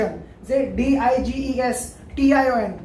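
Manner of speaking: usual speaking voice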